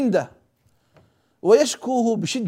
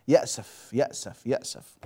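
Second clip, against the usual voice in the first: each repeat softer, the last one whispered; fading to a whisper; 9 semitones lower, 10 dB quieter than usual. Acted. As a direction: let the word die away into a whisper. Preacher repeats word, fading away.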